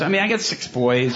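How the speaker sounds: usual speaking voice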